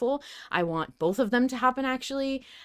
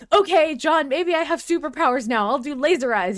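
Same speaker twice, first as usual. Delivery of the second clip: excited, much higher and louder; hopeful Arthur; 7 semitones higher, 6 dB louder than usual. Retold or imitated. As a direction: eagerly try out imagined superpowers. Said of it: "he's like".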